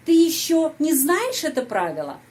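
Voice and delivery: high, loud peak on "не знаешь"; question intonation. Shown as a demonstration. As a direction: ask by lifting "не знаешь", falling after. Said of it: "kalimat tanya". (nada bertanya, tekanan kuat pada "не знаешь")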